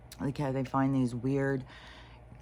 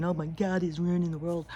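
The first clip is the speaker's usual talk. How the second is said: a touch of higher mock wailing; mock crying panic; 4 semitones higher than usual. People who can't see crying out, lightly mocking dismay. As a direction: lightly mimic a dismayed crowd.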